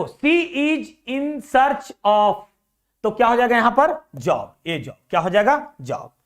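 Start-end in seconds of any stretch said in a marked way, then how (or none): 0.0-2.3 sentence drawn out very slowly; slow reading aloud. Shown as a demonstration. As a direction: read it out word by word. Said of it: "देखो". (वाक्य को बहुत धीरे पढ़ना)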